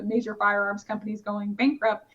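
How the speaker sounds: normal talking voice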